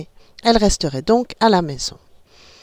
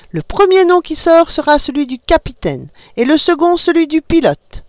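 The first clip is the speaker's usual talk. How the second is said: high, bright-eyed mother explaining a game; playful mother; 7 semitones higher, 3 dB louder than usual. A narrator's reading. Mother explaining the spaceship game to her kids, playful. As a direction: lead the children into make-believe. Mother voiced announcing draw.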